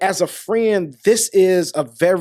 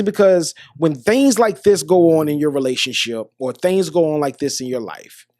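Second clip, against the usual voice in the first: ordinary delivery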